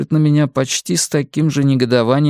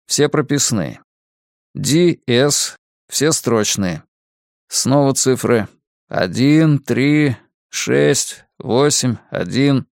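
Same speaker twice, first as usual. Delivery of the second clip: ordinary delivery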